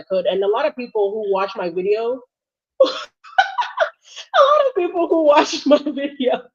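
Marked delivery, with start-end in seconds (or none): 2.5-6.4 slow, higher, laughing through it; can't stop laughing while saying it; laughing through words. Real, not acted. Host cracking up mid sentence, laughing through words.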